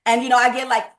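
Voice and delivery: ordinary talk, nothing marked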